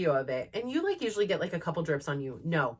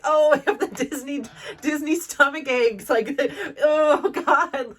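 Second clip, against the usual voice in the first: higher, louder, laughing through the words; 7 semitones higher, 6 dB louder than usual. Retold or imitated. Telling viewers about Disney stomach aches, laughing. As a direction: crack up at the old family joke.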